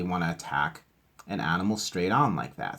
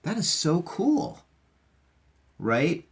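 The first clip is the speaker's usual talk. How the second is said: excited wonder; 10 semitones higher, slower than usual. Real, not acted. Marvels at the fact.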